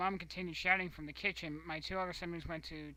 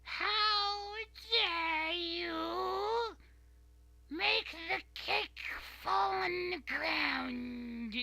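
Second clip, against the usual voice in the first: very high, slow, loud mock screech of an angry mother; hammed-up furious mother yelling about the cake; shrieking mom; 14 semitones higher, 7 dB louder, much slower than usual. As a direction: shriek it like an outraged mom, drawing every word out. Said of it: "she turns around and goes".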